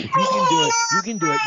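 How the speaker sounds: loud high cheer with a laugh; excited encouragement. Real, not acted. Cheering on the child.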